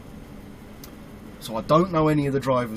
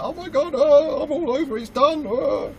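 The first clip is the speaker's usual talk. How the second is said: much higher, louder, panicked squeal; inner panic shriek; 14 semitones higher, 5 dB louder than usual. Retold or imitated. New driver panicking over the dragged landing legs, high panicked squeal. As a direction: squeal it like your head is screaming. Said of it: "At least inside my head, I'm doing that".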